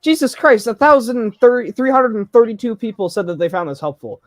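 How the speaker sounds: loud, high disbelief at the number; high, loud astonished outburst; shocked. Real, not acted. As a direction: blurt it out, stunned. Shocked at review count.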